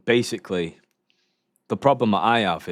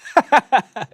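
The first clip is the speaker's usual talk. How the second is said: a high, loud burst of laughter; sudden loud laugh, much higher than his talk; 10 dB louder than usual. Real, not acted. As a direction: explode into laughing at the clip. Reacting to a clip, bursts out.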